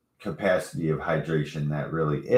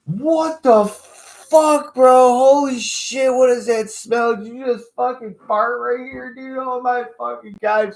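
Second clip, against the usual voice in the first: shrill disgusted roommate; 7 dB louder than usual. Retold or imitated. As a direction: shriek at the smell. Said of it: "She's like".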